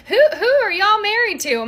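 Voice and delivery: high, disbelieving question; can't believe these women's husbands; incredulous. Retold or imitated. Reacting to the meme in high disbelief.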